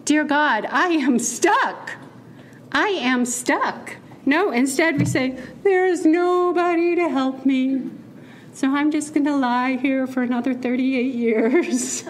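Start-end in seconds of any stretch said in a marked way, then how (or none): 0.0-4.0 raised-pitch plea, slow and emphatic; mock yell. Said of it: "How often do we not yell". 5.6-12.0 mock helpless moaning, pitched up; self-pitying whine. Acts out self-pitying complainer.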